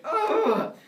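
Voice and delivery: high, loud exasperated ugh; king of gods fed up; frustrated groan. Acted.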